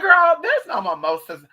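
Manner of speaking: high, loud outburst of disbelief. Retold or imitated. Doubting a woman about local mimosas, loud disbelief. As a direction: burst out high, then drop a little. Retells her disbelieving reply.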